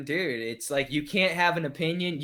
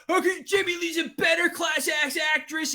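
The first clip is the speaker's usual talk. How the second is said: mocking fans; 12 semitones higher than usual. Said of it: "And the shit".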